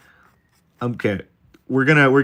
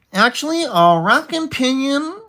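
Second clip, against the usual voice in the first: nerdy know-it-all; 5 dB louder than usual. Acted. Streamer testing a know-it-all voice on chat, very high "actually". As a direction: correct someone like an insufferable nerd. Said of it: "I think we might have it".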